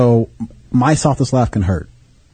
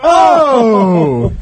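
shocked outcry; 6 semitones higher, 3 dB louder than usual. Real, not acted. Watching the second slap.

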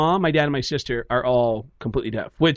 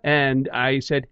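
ordinary talk, nothing marked

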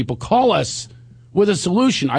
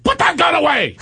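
much higher, louder panicked command; freaked-out yell; 4 dB louder than usual. Retold or imitated. Wife yelling again to put the gun away, high panicked yell. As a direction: scream the order one last time. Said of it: "I've never even seen her like that".

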